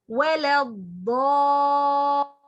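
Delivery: ولا الضالين recited with the long madd on الضالين stretched out; tajwid recitation. Shown as a demonstration. Teacher demonstrating Quran recitation to students. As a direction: chant ولا الضالين, holding the doubled-letter madd six counts. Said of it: "you pronounce ... as this way".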